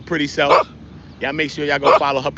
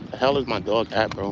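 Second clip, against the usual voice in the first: usual speaking voice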